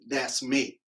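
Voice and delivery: ordinary talk, nothing marked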